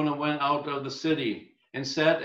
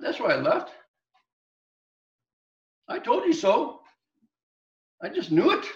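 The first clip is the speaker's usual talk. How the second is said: higher, louder indignant complaint; an exasperated prophet whining I told you so, pitched up; told-you-so Jonah; 7 semitones higher, 3 dB louder than usual. Acted. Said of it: "he said to God".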